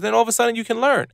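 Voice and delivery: normal talking voice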